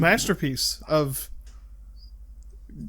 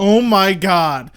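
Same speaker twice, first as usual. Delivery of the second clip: louder, slow delighted exclaim; excited recognition; 3 semitones higher, 7 dB louder, slower than usual. Real, not acted. Friend recalling the elephant clip, excited. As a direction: burst out in recognition.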